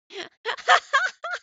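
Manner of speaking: a sudden high-pitched giggle fit; high burst of laughter. Real, not acted. Streamer laughing at mixing up two viewers' names, high giggle. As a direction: laugh out loud at your own name mix-up.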